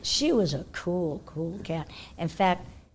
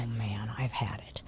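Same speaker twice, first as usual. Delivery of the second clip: whispered dread; 9 semitones lower, 8 dB quieter than usual. Retold or imitated. Dread before the manager.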